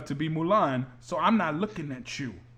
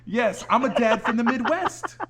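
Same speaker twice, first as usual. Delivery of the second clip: higher, louder, slow mock Midwest dad voice; Mark Wahlberg impression; 8 semitones higher, 7 dB louder, slower than usual. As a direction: ham up an American action star. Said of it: "It's like in the Transformer movies".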